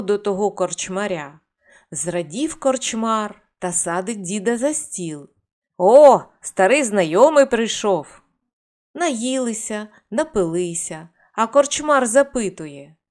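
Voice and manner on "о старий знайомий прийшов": loud, glad welcome; hearty greeting. Acted. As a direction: greet him like a long-lost friend. Innkeeper greets old acquaintance. (гучне радісне привітання)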